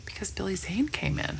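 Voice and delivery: hushed whispered reveal; whispering. Real, not acted. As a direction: whisper the name like gossip.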